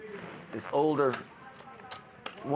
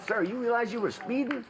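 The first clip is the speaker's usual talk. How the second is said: traffic cop; 10 semitones higher than usual. Acted. Cop addresses driver in joke.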